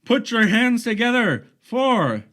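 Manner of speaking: loud, high, stretched-out ring call; boxing announcer. Pretend. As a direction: drag out the name like a champion intro. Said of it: "I started talking like a boxing, uh, announcer".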